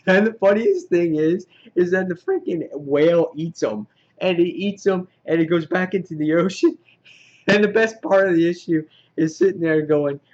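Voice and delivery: high, loud, laughing through the telling; real amusement. Real, not acted. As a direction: crack up while telling the funniest part. Cracking up at the funniest part.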